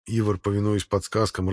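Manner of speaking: plain reading voice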